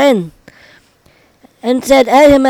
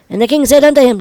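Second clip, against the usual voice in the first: ordinary talk, nothing marked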